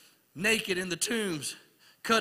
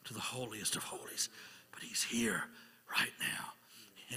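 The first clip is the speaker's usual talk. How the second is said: hushed whisper; 11 semitones lower, 15 dB quieter than usual. Real, not acted. Preacher whispering to the congregation in awe. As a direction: whisper it, barely voiced, awed.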